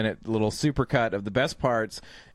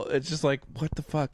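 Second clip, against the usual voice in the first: usual speaking voice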